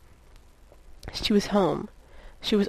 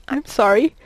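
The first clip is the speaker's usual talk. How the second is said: choked apology, louder and higher; 4 semitones higher, 5 dB louder than usual. A narrator's reading. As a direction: blurt the apology through a tight throat. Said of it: "he choked, caught dead to rights".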